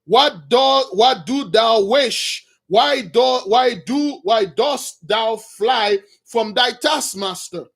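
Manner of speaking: normal talking voice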